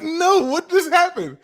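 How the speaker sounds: high, loud startled question; surprised. Retold or imitated. Answering the clerk after the beer run, high surprised question. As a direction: sound caught off guard.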